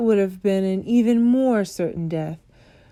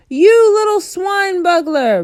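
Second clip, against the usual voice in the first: much higher, loud and slow furious roar; 11 semitones higher, 8 dB louder than usual. A narrator's reading. Bloodbottler roaring at the BFG, furious and loud. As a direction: bellow the insult. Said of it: "roared the blood bottler".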